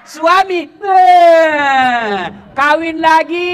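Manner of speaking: high, loud teasing exclamation; comic loud punchline. Real, not acted. Preacher teases wives with the punchline, high and loud. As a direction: shout the joke high and loud.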